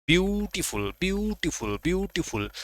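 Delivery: Beautiful with a high, stressed first part falling at the end. Shown as a demonstration. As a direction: punch the start of beautiful high, then let it drop. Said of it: "आपको जोर देना है".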